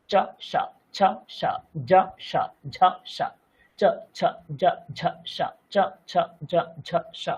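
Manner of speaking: স hushed like শ after চ ছ জ ঝ; palatal s drill. Shown as a demonstration. Teacher drilling the palatal স sound. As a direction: keep the tongue where চ sits and say স. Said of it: "জিভের অবস্থানটা সেখানেই রেখে".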